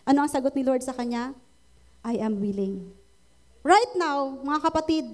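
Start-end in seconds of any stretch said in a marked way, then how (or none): 2.1-2.9 gentle voice of Jesus. Retold or imitated. Preacher voices Jesus answering.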